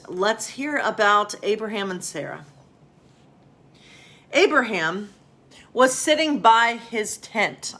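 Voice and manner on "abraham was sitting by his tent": higher, louder storybook narration; story reading. A narrator's reading. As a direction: read it like a children's bedtime story. Starts reading Bible story.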